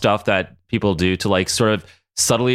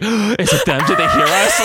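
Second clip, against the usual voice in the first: mock panic; 7 semitones higher, 4 dB louder than usual.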